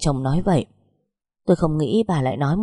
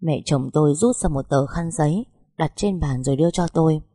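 ordinary delivery, nothing marked

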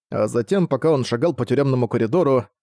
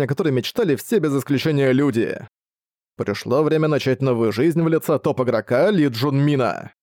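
ordinary delivery

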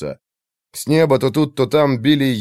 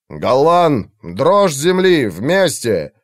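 battle call; 3 semitones higher, 4 dB louder, much slower than usual. Acted. Mage calls for joint spell.

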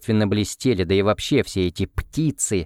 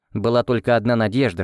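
plain reading voice

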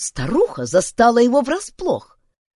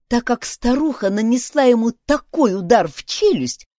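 ordinary delivery

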